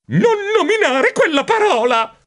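high, fearful outburst; shuddering protest. A narrator's reading. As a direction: cry out as if the word makes you shudder. (protesta acuta, con i brividi)

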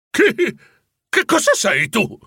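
high, startled question to the apparition; spellbound surprise. A narrator's reading. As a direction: gasp out the question in wonder. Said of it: "rapito da quella visione abbagliante".

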